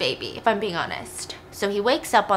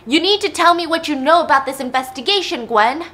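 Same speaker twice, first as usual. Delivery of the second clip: loud, raised acted interrogation; angry demand; 5 semitones higher, 5 dB louder than usual. Dad demands to know about the investigation, acted angry. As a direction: press hard for an answer, voice up. Father demands answers from daughter.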